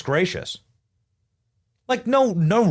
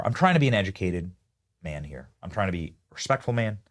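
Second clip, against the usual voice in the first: ordinary delivery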